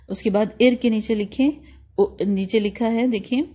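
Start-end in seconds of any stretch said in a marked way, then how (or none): none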